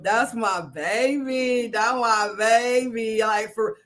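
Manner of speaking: normal talking voice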